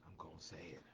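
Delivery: hushed. Acted. Host teasing viewers before speaking out, hushed and low. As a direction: let the line fall to a hush. Repeated promise, hushed then loud.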